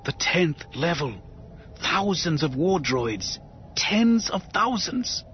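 fearful warning building up; stately alien official. Acted. Maiden warns.

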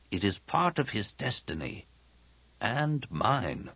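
soft, measured pronouncement; grave old master. Acted. Old master speaks of shared destiny, solemn. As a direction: let it fall softly, as a wise elder.

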